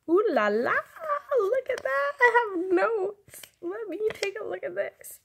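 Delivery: high, excited mock surprise; ooh, what did I find here; pleasantly surprised. Real, not acted.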